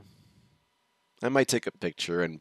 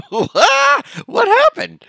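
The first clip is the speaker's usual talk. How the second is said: shocked nurse; 18 dB louder, slower than usual. Retold or imitated. Nurse shocked at bloody kid.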